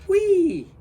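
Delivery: high, loud whoop of delight; a bird's joyful squeal; gleeful yell. A narrator's reading. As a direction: shriek it out with glee. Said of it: "Gertrude yelled".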